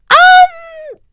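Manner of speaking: high, loud shouted refusal; mock shout. Coworker to angry customer, loud mocking shout. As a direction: yell a flat no.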